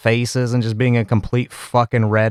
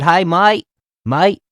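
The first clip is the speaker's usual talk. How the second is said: higher-pitched attempt at an Australian "mate"; not-very-good Australian accent; 6 semitones higher, slower than usual. Host copying the Australian interviewee, rough Australian accent. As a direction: try an Australian accent, not quite landing it. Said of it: "I don't do a very good Australian".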